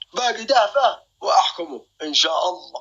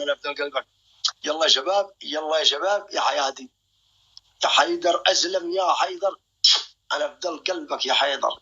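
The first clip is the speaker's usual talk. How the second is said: ordinary delivery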